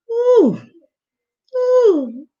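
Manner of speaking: high, loud hype whoop; excited whoop. Real, not acted. Streamer reacting to the song with a high excited whoop. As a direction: whoop like the tune just hit. Hyped reaction while music plays.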